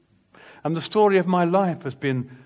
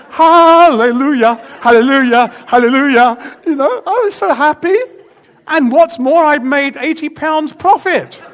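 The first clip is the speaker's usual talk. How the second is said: joyful hallelujah; 11 semitones higher, 13 dB louder than usual. Retold or imitated. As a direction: shout for joy while dancing. Dancing joy after free repair.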